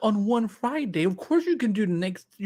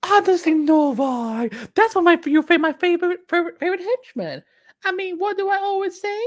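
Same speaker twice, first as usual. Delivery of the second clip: high, loud cartoonish character voice; 10 semitones higher, 6 dB louder than usual. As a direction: push the voice way up for the quote. Performing a henchman-loving character quote.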